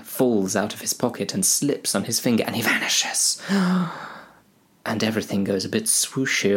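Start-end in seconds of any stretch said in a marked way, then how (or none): 2.4-3.4 whisper. Acted. Frodo vanishing, whispered. 3.5-4.1 a quick high gasp of shock; sharp high gasp. Acted. A sharp high gasp as Frodo vanishes.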